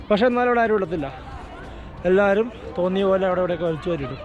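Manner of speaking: ordinary talk, nothing marked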